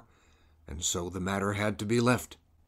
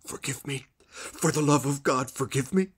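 pleading; 7 semitones higher than usual. Acted. Kneeling murderer begs forgiveness.

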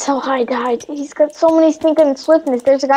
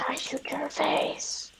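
teasing whisper; 15 dB quieter, slower than usual. Pretend. Teasing threat in game.

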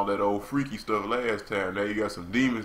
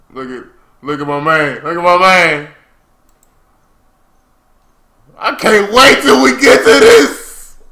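excited yell, building louder and higher; hyped shouting; 7 semitones higher, 16 dB louder than usual. Real, not acted. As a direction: build into a high, thrilled shout. Hyped about coming animation.

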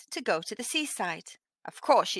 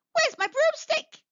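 much higher, frantic question; a high, anxious witch voice; panicked cry. Acted. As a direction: search in a panic. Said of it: "cried Winnie".

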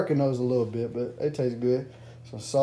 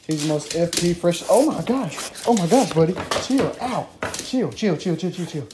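ordinary talk, nothing marked

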